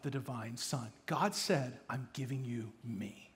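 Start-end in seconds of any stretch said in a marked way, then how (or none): 1.9-3.3 tender. Acted. God voiced tenderly.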